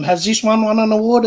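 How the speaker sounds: real surprise, voice jumps up; surprised. Real, not acted. Excited discovery while tasting.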